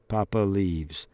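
plain reading voice

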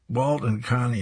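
plain reading voice

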